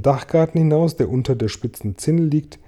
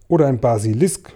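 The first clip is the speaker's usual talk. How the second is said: ordinary delivery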